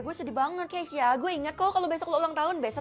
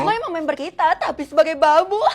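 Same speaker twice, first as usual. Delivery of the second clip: laughing mockery; 8 dB louder than usual. Bullies mocking new member.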